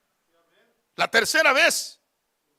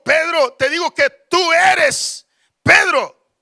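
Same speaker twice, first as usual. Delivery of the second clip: loud, emphatic declaration with stretched words; rally the congregation with thundering conviction; full-force preaching; 4 semitones higher, 7 dB louder than usual. Real, not acted. Preacher declaring the church will prevail, full-force preaching.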